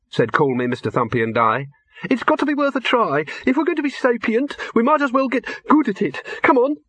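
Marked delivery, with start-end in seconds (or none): 2.1-6.8 much higher small rabbit; squeaky little rabbit reporting back; high bunny voice. Acted. Rabbit speaks.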